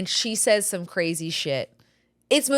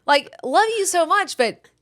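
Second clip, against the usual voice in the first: mock lecturing mom; 6 semitones higher, 3 dB louder than usual. Mom lecturing her daughter, hammed-up stern. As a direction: lecture the child with exaggerated patience. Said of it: "I was like".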